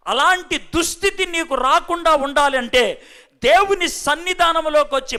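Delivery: usual speaking voice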